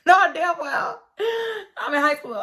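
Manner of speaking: cracking up at herself; laughing. Real, not acted. Laughing at her own slip.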